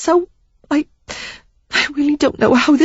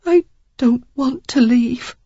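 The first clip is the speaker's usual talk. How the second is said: high, slow, choked with emotion; choked emotional plea; thickly; 9 semitones higher than usual. A narrator's reading. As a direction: say it thick with tears. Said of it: "she said thickly".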